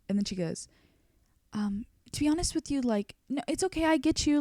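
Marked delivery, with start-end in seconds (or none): 1.5-4.4 high-pitched sympathetic hedging; lightly put-on voice, reassuring upset client; imitated soothing stylist. Stylist comforting crying client, imitated high soothing voice.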